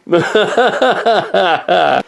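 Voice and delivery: long, high staccato laugh; high, drawn-out laughing fit; laughter. Real, not acted. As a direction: burst into a rattling high laugh. Laughing at own stroke joke.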